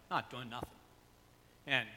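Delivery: usual speaking voice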